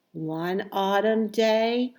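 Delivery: ordinary delivery, nothing marked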